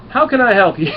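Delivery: high, loud comic shopkeeper voice; eager alien shopkeeper. Retold or imitated. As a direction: greet a rich customer with hammed-up eagerness. Voicing alien shopkeeper eyeing gold coins.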